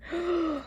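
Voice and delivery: quick whispered gasp of alarm; a startled intake of breath; sharp gasp. Acted. Reader reacting as the door creaks open, whispered gasp.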